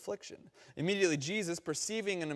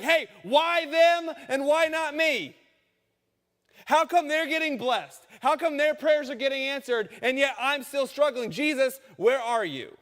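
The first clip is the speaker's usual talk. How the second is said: higher, louder, slow plea to Jesus; pleading complaint; 12 semitones higher, 11 dB louder than usual. Retold or imitated. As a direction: cry out why not me. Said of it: "And you're like".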